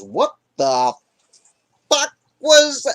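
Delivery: high, loud startled curse; shocked outburst. Acted. Saul spotting his cut robe, startled loud curse. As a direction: blurt it out in disbelief.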